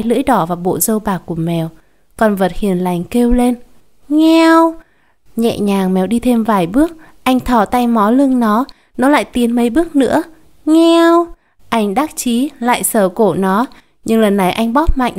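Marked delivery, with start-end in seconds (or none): 4.1-4.7 high, loud meow; a cat mewing sharply; imitated cat cry. Cat meows in story. 10.7-11.3 high, loud meow; a cat mewing sharply; imitated cat cry. Cat meows in story.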